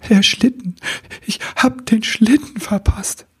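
high, breathless despair over the missed sleigh; upset cry. Acted. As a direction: blurt it out in dismay. Sobbing elf tells his loss.

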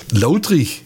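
„Lautrig“ deutlich vorgesprochen, fallend; Mundartwort vorgesagt. Shown as a demonstration. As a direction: sprich „Lautrig“ wie eine Vokabel auf Schwäbisch. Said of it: "Kennst du das Wort?". (Dialektwort deutlich vorgesagt)

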